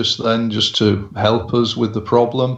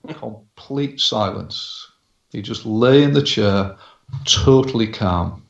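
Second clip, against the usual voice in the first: normal talking voice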